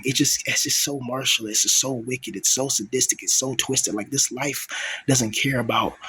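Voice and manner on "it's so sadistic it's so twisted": ordinary talk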